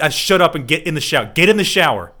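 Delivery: loud exasperated yelling, laughing; mock angry shout. Cohost voicing the annoyed girlfriend, loud mock yelling. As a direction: bark the order through laughter.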